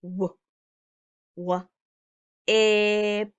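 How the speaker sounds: W stretched, then ip on its own; sounding out. Shown as a demonstration. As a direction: break Whip into its sounds. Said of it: "sound out the word".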